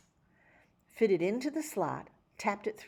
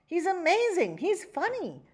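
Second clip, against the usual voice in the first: excited fan; 10 semitones higher, 4 dB louder than usual. A narrator's reading. A fan praises the jingle.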